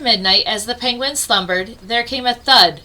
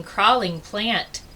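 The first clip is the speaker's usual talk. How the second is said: ordinary delivery, nothing marked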